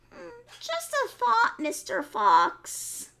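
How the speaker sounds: high, slow coaxing piglet; sly piglet. Acted. Piglet coaxing the fox to wash him. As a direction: sweet-talk the fox.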